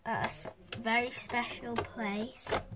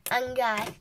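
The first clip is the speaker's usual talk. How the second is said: usual speaking voice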